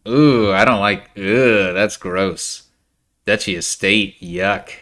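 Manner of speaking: loud, grossed-out groan; disgusted. Real, not acted. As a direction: recoil from something gross. Reacting to a bad buy.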